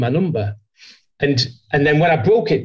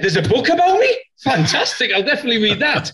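much higher, hammed-up American-accented thrill; mock delight; 10 semitones higher than usual. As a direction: squeak it out like you're flattered. Mock-naive delight at own story.

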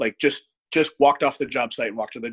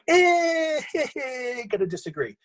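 much higher, a sing-song chuckling brush-off; teasing dismissal; 12 semitones higher, slower than usual. Retold or imitated. Reacting to his cohost's online comment, teasing. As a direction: wave the idea away with a teasing laugh.

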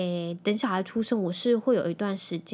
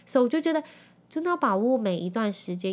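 normal talking voice

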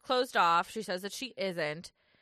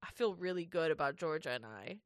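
lightly voiced self-satisfied guy, a bit lower and softer; mock smug contestant; 6 semitones lower, 6 dB quieter than usual. Host imitating the contestant leaving the chat, in a lightly smug voice. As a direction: play him oblivious and pleased.

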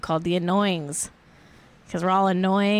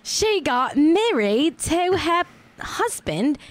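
put-on a shaky stab at an Australian accent, higher and slow; attempted Australian accent; 5 semitones higher, slower than usual. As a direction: try the Australian accent and lose it halfway. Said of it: "no, I can't do it now".

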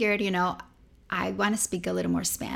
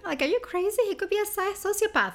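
much higher, incredulous squeak; alarmed protest; 12 semitones higher than usual. Retold or imitated. Refusing her cousin's advice, high incredulous. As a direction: recoil at the idea.

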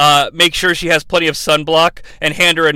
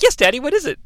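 chipper Kelly; 8 semitones higher, faster than usual. Retold or imitated. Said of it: "she's like".

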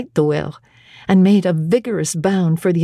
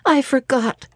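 lightly voiced exclamation; 6 semitones higher than usual. A narrator's reading. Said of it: "she exclaimed".

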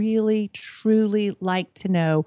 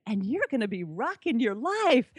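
put-on North Carolina accent; 3 semitones higher than usual. Retelling a guest's line, mild North Carolina accent. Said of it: "she said it with this gorgeous North Carolina accent".

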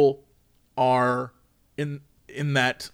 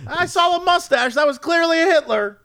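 high, exaggerated insistence; acted stubborn indignation; mock defensive arguing; 12 semitones higher than usual. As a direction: argue back in a silly high voice. Acting out stubborn argument with chat.

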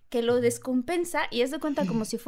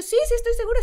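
frantic insistence; 11 semitones higher, 9 dB louder, faster than usual. Retold or imitated. Insisting, frightened.

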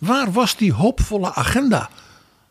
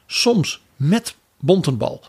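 ordinary delivery

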